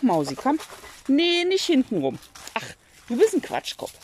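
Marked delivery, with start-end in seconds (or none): none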